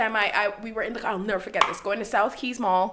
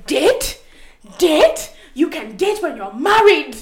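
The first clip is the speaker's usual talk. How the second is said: strict dad snapping, louder, higher; angry dad; 6 semitones higher, 10 dB louder, slower than usual. Retold or imitated. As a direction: bark it like an outraged father. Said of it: "stopped the car and says".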